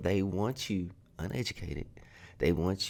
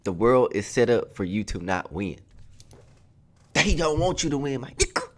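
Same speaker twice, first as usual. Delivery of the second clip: high, loud ranting peak; escalating comedy rant; 5 semitones higher, 5 dB louder than usual. Real, not acted. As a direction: push it up into a near yell. S0 rant climax.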